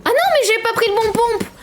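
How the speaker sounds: high-pitched frantic wail; panicked dismay. Real, not acted. Panics over picking wrong weapon. (plainte paniquée, voix aiguë)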